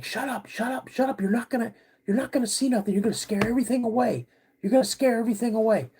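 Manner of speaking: high, rushed imitation of an exasperated dad; high-pitched frantic telling-off; retold urgent scolding. Dad scolds his screaming son.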